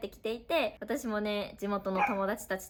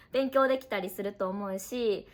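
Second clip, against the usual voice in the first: normal talking voice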